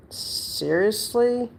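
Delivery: drawn-out exasperation. Retold or imitated. Retorting to the old landlord, high and drawn-out disbelief. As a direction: draw "Seriously?" out high and slow in disbelief.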